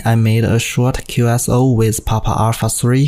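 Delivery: normal talking voice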